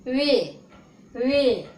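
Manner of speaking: "वे" stretched, falling in pitch; long e vowel. Shown as a demonstration. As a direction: draw out the e in "वे". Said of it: "लंबा करके". ("वे" को लंबा खींचकर)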